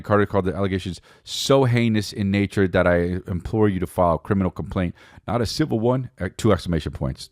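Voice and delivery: usual speaking voice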